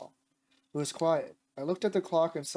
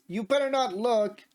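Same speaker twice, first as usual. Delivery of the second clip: raised-pitch, drawn-out warning; mild menace; 6 semitones higher, slower than usual. A narrator's reading. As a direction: warn them slowly with a hint of threat. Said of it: "she said with a scary face".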